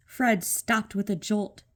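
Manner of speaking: ordinary delivery, nothing marked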